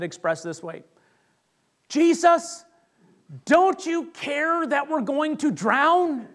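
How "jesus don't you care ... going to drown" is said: high, loud, drawn-out panicked plea; hammed-up frightened disciples; acted panic. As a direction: cry out to be saved, much higher. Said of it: "Like, there's this panic".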